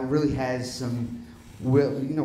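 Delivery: normal talking voice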